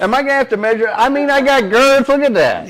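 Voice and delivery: loud, high-pitched playful protest; mock indignant. Real, not acted. Dad defending his hands to teasing co-hosts, mock indignant. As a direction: protest loudly amid the teasing.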